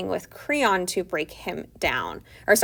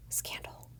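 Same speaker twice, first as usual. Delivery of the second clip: breathy stage whisper of mock shock; 19 dB quieter than usual. Teacher whispering the twist to the class, mock scandalized. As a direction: hush it like juicy gossip.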